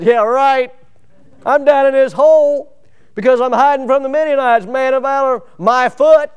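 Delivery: high, loud scoff, yeah right; mock the angel's praise; sarcastic Gideon. Do you see the humor.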